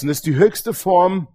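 ordinary talk, nothing marked

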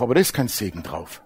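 low, quiet rebuttal; sober correction. Real, not acted. Preacher correcting what people think, low and sober. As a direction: drop pitch and volume to contradict. Preacher refutes the popular belief.

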